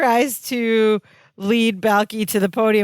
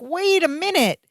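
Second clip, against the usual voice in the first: startled objection; 8 semitones higher than usual. Retold or imitated. Larry halts the host's explanation.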